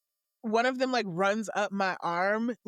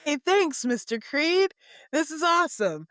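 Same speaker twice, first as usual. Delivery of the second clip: cheerful thanks; 7 semitones higher, 4 dB louder than usual. Acted. Garen thanks Creed.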